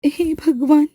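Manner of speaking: dread. Acted. Rajni dreads a slap.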